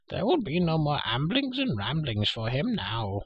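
high, slow creature wail; moaning Gurgi. A narrator's reading. Injured creature tells of his leg, moaning. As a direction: moan pitifully in a high creature voice. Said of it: "he moaned".

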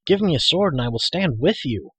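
high, rousing battle cry; eager, impetuous exhortation; bold declaration. A narrator's reading. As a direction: urge a fight with hot-headed zeal. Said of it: "Taran declared".

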